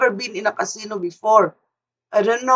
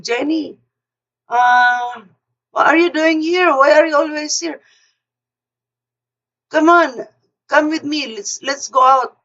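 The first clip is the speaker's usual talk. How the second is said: higher, livelier imitation of a friend coaxing; eager friend urging; 6 semitones higher, 3 dB louder than usual. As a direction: pitch it up, coax her out to the party. Friend urging her to a party.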